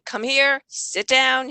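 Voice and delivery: bossy command. Shown as a demonstration. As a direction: snap it out like a nagging parent. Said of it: "instead of saying".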